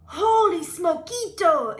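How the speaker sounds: high, loud, drawn-out surprise; astonished kitten outburst; amazed exclamation. A narrator's reading. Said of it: "exclaimed Skippy John Jones".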